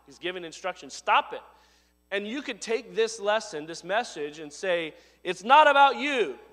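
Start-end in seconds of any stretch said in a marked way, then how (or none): 5.2-6.4 loud, high scolding; preachy chastisement. Shown as a demonstration. Preacher scolding the congregation, loud and stern. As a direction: hammer it at them like a rebuke. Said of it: "I can preach at you like that".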